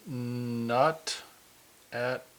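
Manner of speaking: slow, one word at a time; drawn-out denial. Pretend. Host denies trying to kill time, drawn-out denial. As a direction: stretch each word with long pauses between. Deadpan answer to killing-time question.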